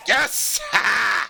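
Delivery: sharp, exultant cheer; triumphant cry. Real, not acted. Gamer finally catches the prey, triumphant cry. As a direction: burst out in victory.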